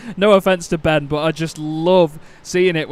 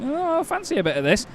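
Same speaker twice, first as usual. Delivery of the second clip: high, lightly acted musing; mock inner voice, higher than the commentary; imagined driver's thought; 9 semitones higher, 4 dB quieter than usual. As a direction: voice a rival's eager thought, pitched up. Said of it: "think of that with a Scottish accent".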